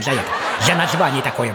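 normal talking voice

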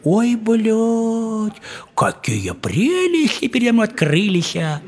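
high leering voice, lightly put on; falcon man leering. The falcon man spots the sleeping girl, high mock leer. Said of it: "говорит".

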